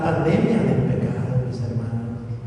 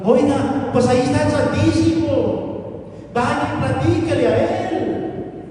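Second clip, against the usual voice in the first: preacher calling out to a complainer, higher and slower; 8 semitones higher, slower than usual. Retold or imitated. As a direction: sing it out, pointing them to the chapel.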